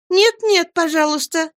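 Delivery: put-on high-pitched girlish plea; little cry. A narrator's reading. Dora stops her husband with kisses, high cry. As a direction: cry out high, pleading playfully. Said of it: "крикнула Дора".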